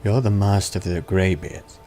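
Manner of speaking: normal talking voice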